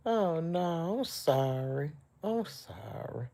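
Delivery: soft, emotional apology, sinking and slowing; quiet and overcome; tearful apology. Real, not acted. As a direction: apologise quietly through tears. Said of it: "I'm just an emotional wreck".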